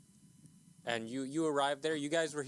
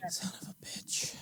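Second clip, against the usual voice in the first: whispered curse; 7 semitones higher, 4 dB quieter than usual. Real, not acted. Frustrated tech problems.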